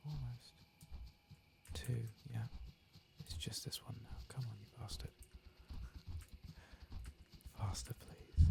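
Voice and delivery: usual speaking voice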